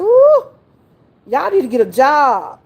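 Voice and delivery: high, loud Ooh, then scolding; a high, loud Ooh, then tell the kids to go work; exasperated grandma. Retold or imitated. Grandma telling summer kids to get a job, exasperated. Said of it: "I said".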